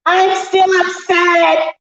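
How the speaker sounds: exaggerated, high-pitched sulky protest; mock upset wail. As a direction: wail the complaint in a silly high voice. Mock complaint in put-on voice.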